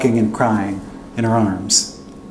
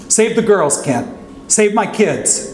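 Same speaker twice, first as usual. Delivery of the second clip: high, slow, pleading shout; desperate plea; 9 semitones higher, slower than usual. Retold or imitated. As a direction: beg with the last breath you have. Uncle's last plea while slipping.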